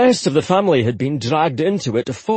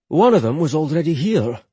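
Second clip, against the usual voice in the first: ordinary delivery, nothing marked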